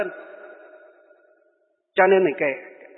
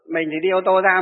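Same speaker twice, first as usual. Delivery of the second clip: ordinary talk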